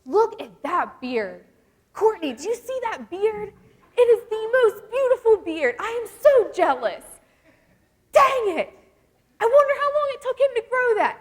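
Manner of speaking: hammed-up beard envy, high and loud; imitating a jealous, overexcited fiance; obsessed fiance's envy. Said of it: "That's my fiance's thing".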